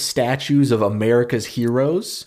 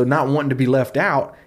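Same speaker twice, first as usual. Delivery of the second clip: normal talking voice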